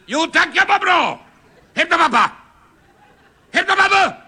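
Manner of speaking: loud, high-pitched yell; exasperated shouting. Acted. Caller with burning house yelling at a child, exasperated. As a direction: shout an urgent order down the phone.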